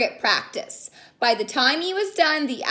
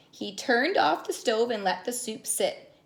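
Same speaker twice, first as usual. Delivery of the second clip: ordinary delivery, nothing marked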